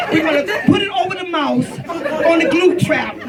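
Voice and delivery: ordinary talk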